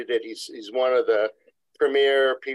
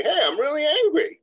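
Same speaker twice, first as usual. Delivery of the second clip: open anger. Retold or imitated. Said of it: "Like, you can say".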